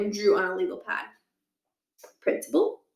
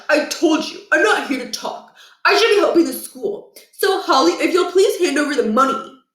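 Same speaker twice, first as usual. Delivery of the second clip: put-on a higher, pushy boy demanding the cash; Josh's voice; 7 semitones higher, 4 dB louder than usual. Josh demands Holly hand over the money, insistent. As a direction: press her to hand it over.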